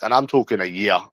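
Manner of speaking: ordinary talk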